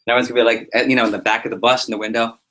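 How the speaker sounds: ordinary talk, nothing marked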